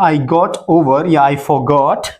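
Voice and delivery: very slow, both options spelled out; slow dictation voice; slow model answer. Shown as a demonstration. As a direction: say them slowly for students. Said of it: "teacher models English answer".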